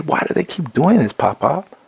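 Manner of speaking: put-on high, pleading child's voice; upset child near tears. Son asking grandpa why police are mean, high tearful plea. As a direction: ask it like a little boy close to crying. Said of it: "and he's almost in tears".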